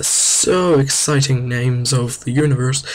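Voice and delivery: slow, drawn-out sarcasm; hammed-up sarcastic awe; mock excitement. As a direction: stretch it out with fake wonder. Sarcasm about dull planet names.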